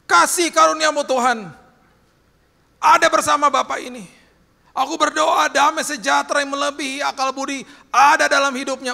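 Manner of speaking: loud, high-pitched full-force prayer; fervent prayer. Retold or imitated. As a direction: cry out a blessing to God. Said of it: "saya berdoa pinggir jalan".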